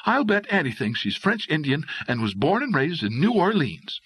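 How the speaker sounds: high, catty woman's voice; jealous girlfriend. A narrator's reading. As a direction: dish the dirt on a rival.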